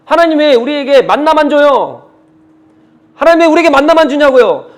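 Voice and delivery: imitating grumbling people in protest; complaining. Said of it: "막 그러면서 불평한단 말이에요".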